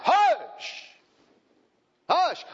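high, loud scolding hush; sharp hush. Acted. Preacher shushing the whining mother, loud and high. Preacher silences the mock complainer.

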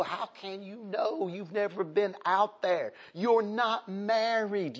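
high, rising preacher's cry; preaching at full force. Acted. Preaching climax.

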